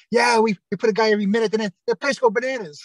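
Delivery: a light mock pitch of the Royal Rumble idea; Pat Patterson's voice. Pat pitching the Royal Rumble, lightly imitated and excited. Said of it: "He was like".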